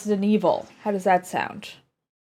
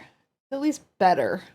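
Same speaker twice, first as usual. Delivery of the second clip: ordinary talk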